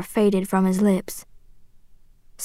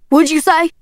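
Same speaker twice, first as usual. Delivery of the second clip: higher, sharp demand; angry challenge; 6 semitones higher than usual. A narrator's reading. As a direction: whirl round and challenge. Father whirls back, challenging her.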